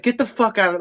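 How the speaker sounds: high-pitched mock outburst; retold angry order. Jeff kicking out an arguing customer, mock angry. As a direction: throw him out, voice jumping up high.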